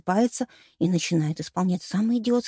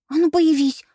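loud, higher angry command; stamping demand; 8 semitones higher, 6 dB louder than usual. A narrator's reading. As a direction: stamp your foot and order it. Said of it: "топнула ногой я".

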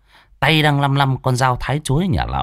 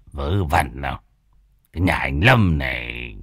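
put-on coy old man; 8 semitones lower than usual. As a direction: shrug it off, secretly pleased. Phọt playing coy.

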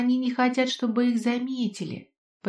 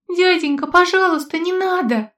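high, loud thin wail; thin wailing plea; 9 semitones higher, 6 dB louder than usual. Acted. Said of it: "тоненько заголосила она".

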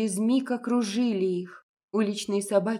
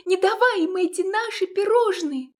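put-on high, loud hysterical girl; hysterical cry; 11 semitones higher, 6 dB louder than usual. A narrator's reading. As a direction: shriek it in panic. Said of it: "воскликнула истерично Лиза".

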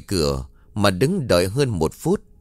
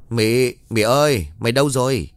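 calling out; 3 semitones higher than usual. Acted. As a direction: call through the house to find someone. Calling out for missing Mỹ.

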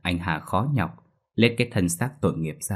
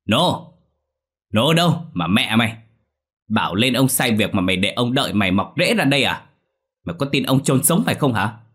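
louder, higher bellowing call; shouting for servant; 4 semitones higher, 5 dB louder than usual. Acted. Master summoning his late servant, angry shout. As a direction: yell for the servant across the house. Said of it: "ông quát".

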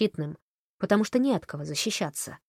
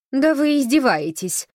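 ледяным голосом; 8 semitones higher, 7 dB louder than usual. A narrator's reading. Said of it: "произнесла она ледяным голосом".

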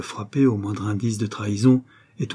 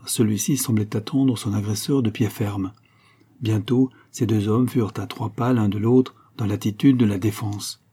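ordinary delivery, nothing marked

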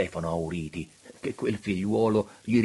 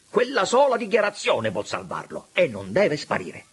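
high, loud urgent insistence; pressing; 9 semitones higher, 5 dB louder than usual. A narrator's reading. Auriti demands the only saving declaration, pressing. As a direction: drive the point home, raised voice. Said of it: "incalzò Lauriti".

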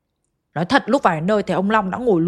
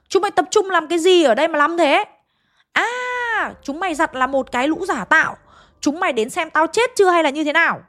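scolding rant; 7 semitones higher than usual. A narrator's reading. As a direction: tear into the whole family. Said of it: "chửi xa xả luôn". (chửi xa xả, giọng cao và to)

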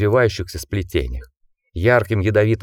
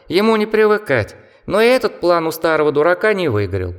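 high squeaky mocking bat; 10 semitones higher than usual. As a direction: chatter like a scornful little bat. Bat character speaks at length.

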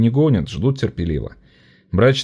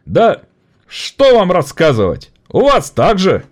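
higher, weary rousing close; orator; 10 semitones higher, 5 dB louder, slower than usual. Acted. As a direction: wave it off to the crowd. (оратор устало)

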